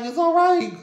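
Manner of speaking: very high, loud retold cry; acted exclamation. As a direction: re-enact it at the top of your range. Retold reaction with onlookers watching.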